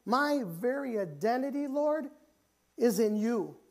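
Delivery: impassioned acted cry; crying out to God. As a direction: pray it out as David, pitched high. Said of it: "David's cry out saying".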